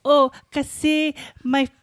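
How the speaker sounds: put-on high, drawn-out Filipino accent. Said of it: "And I was like".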